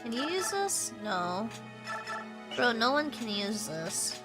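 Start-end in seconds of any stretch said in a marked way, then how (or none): none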